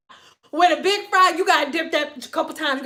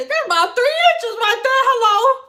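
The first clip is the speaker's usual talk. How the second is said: higher and louder, laughing through it; laughing cracking up; 8 semitones higher, 4 dB louder than usual. Real, not acted. Jokes to viewers about her body, cracking up laughing, higher and louder. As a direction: crack up at your own joke.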